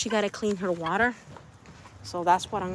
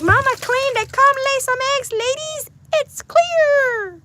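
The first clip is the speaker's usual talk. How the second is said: high, loud squeaky chicken voice; 9 dB louder, slower than usual. Retold or imitated. Voicing the excited chicken to mama, high and loud. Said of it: "He's like".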